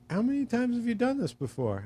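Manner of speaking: high-pitched doubtful query; retold wary question. Homeowner watching friend glaze windows, high and doubtful.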